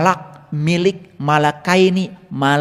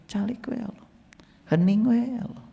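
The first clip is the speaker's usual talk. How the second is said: hushed; 2 semitones higher, 9 dB quieter than usual. Retold or imitated. Worshipper telling God to stay quiet, hushed mock. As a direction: shush God as if he's a nuisance. Mocking people hushing God.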